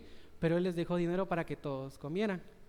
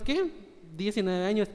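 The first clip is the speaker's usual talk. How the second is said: ordinary talk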